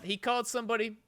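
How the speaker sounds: ordinary talk, nothing marked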